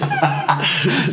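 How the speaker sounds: high burst of laughter. Real, not acted. Laughs at the word placenta.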